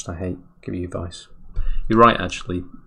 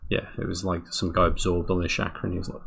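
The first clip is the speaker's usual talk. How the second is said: ordinary delivery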